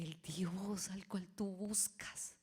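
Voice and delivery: slow whispered comfort; hushed reassurance. Retold or imitated. Pastor retelling his whispered comfort to the mother. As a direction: whisper it slowly to steady her.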